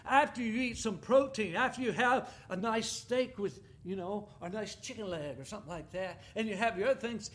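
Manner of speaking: ordinary delivery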